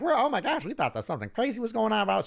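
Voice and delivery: high, hurried imitation of a flustered woman; chatty woman. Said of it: "the woman's like".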